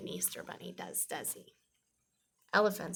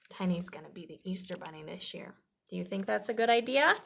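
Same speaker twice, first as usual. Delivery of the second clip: ordinary delivery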